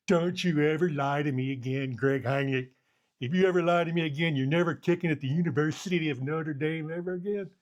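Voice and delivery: mock angry coach. Coach scolding his kicker after the miss, mock furious. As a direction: chew him out like a furious coach.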